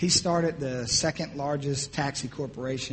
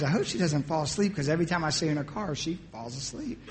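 ordinary talk

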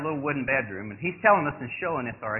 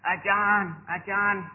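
high-pitched call to John; mock summons, pitched up; imitated calling out; 7 semitones higher, much slower than usual. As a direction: call up to a sleeper from outside. Shaman calling him from outside.

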